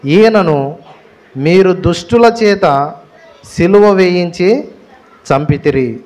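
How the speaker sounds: slow, measured Bible reading; scripture reading. Acted. Pastor reading Acts to the congregation, slow and measured. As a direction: read each phrase deliberately, pausing. Reads Bible verse aloud.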